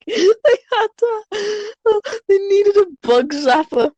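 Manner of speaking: high, helpless laughter through words; laughing. Real, not acted. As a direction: struggle to get it out for laughing. Cracking up at the story.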